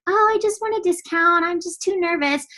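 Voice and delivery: imitating a discount coach who is too nervous to commit; timid new coach. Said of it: "has a discount coach been like".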